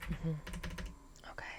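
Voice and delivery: ordinary talk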